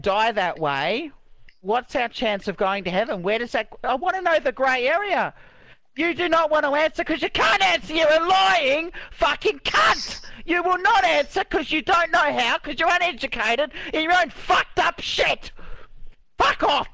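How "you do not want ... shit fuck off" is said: high-pitched, furious outburst; real anger. Real, not acted. Accusing the other player of lying, real high-pitched rage. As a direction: lash out at someone refusing to answer. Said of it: "You're making me angry".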